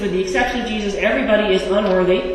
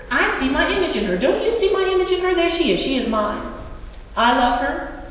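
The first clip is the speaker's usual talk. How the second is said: higher-pitched, doting pride, easing down by the end; proud parent boast; 7 semitones higher than usual. Acted. God pointing out the baptized child, doting and proud. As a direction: dote on your child, showing them off.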